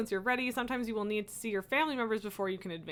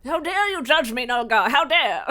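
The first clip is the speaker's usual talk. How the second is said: mock outrage; 8 dB louder than usual.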